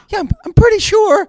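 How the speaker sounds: high, loud, exasperated insistence; indignant retort. Retold or imitated. Retelling his reply to a rude stranger, indignant. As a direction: blurt it high and loud, affronted.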